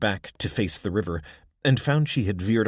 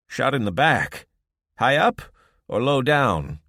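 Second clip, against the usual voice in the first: higher, louder, brisk questions; gruff old woman; 5 semitones higher, 7 dB louder than usual. A narrator's reading. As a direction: ask like a practical healer. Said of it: "Irma asked".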